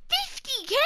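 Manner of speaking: very high-pitched squeal of disbelief; shocked disbelief. Real, not acted. Gamer reacting to a card's price, shocked disbelief. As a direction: squeak it out, astonished.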